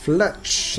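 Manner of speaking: ordinary talk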